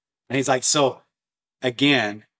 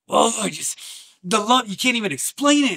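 overwhelmed; 10 semitones higher than usual. Real, not acted. Overwhelmed by God's love.

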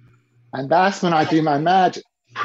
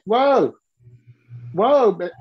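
mock alarmed whoa; 5 semitones higher, slower than usual. Stopping client from overpaying.

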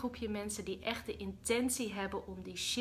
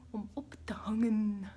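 whispered ending. Real, not acted. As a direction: whisper the goodbye. Whispered sign-off.

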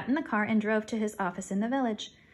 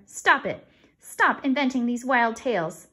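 loud, sharp and slower, higher; sharp scolding; 3 semitones higher, 7 dB louder, slower than usual. A narrator's reading. Mother ordering Fern to stop, loud scolding. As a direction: lay down the law. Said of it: "snapped her mother".

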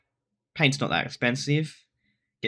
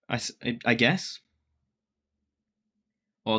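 ordinary delivery

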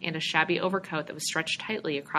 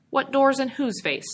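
higher and louder, mocking disbelief; scoffing; 8 semitones higher, 5 dB louder than usual. George mocks the stranger's offer, scoffing. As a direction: throw the question back with scorn. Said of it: "George scoffed".

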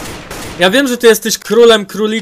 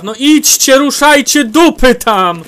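loud, high, dragged-out order; impatient yell; 5 semitones higher, 5 dB louder, slower than usual. Real, not acted. Gamer ordering teammates to advance, impatient yell. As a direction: push them to move.

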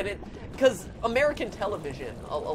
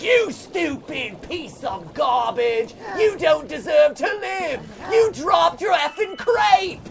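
slow, much louder and higher furious screaming; Gordon Ramsay rant; 9 semitones higher, 8 dB louder, much slower than usual. Retold or imitated. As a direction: scream it at him like an enraged TV chef. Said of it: "Gordon Ramsay's in his face being like".

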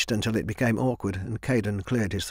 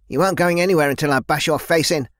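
louder, higher furious threat; shouting; 6 semitones higher, 5 dB louder than usual. Acted. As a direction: yell the threat at her. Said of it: "Thais shouted".

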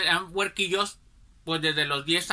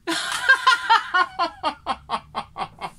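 high, hearty burst of laughter; loud laugh; 12 semitones higher, 5 dB louder than usual. Real, not acted. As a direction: crack up at the memory. Laughing at own story. (carcajada fuerte)

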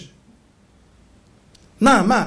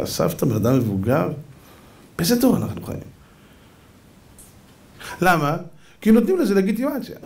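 usual speaking voice

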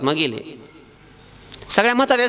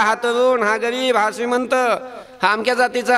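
usual speaking voice

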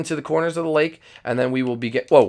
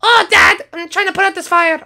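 put-on very high kid character voice; Skittles voice; 6 dB louder than usual. Skittles explaining his crash to Dad, very high kid voice. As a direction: chirp it like an eager young son.

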